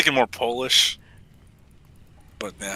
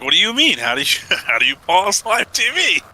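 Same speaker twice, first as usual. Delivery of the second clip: put-on clueless old man voice; 7 semitones higher, 5 dB louder than usual. Retelling a clueless older man.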